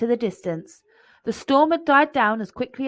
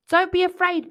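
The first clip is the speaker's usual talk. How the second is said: higher, louder shout against the wind; shouted over storm; 9 semitones higher, 5 dB louder than usual. Acted. Bear to the boy in the storm, raised reassuring shout. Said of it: "Big Bear shouted".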